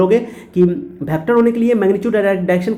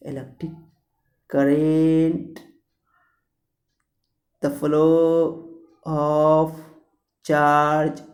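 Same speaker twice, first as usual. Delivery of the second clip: slowed-down playback; 3 dB quieter, much slower than usual. Shown as a demonstration. Teacher mimics a slowed video for students, very slow and drawn out. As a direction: stretch every syllable as if the playback speed were turned down. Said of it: "speed अगर कम कर दोगे, तो एगो ऐसे चलेगा".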